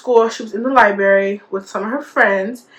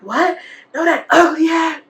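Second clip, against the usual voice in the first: retold snub, pitched up; mean girl; 7 semitones higher than usual. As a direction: imitate her scornful dismissal. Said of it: "She was a mean girl".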